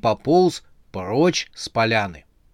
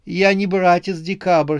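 high-pitched, timid objection; feeble protest; 7 semitones higher, 4 dB louder than usual. A narrator's reading. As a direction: protest helplessly in a raised voice. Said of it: "слабо стал протестовать Седобородый". (слабо протестуя)

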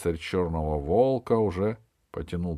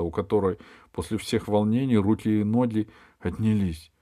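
ordinary delivery, nothing marked

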